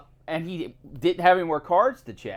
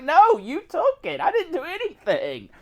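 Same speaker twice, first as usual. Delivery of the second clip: high-pitched defensive comeback; indignant retold protest; 11 semitones higher than usual. Recalling being yelled at by the card tester, indignant protest. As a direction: push the blame back, voice pitched way up. Retold quarrel with blaming coworker.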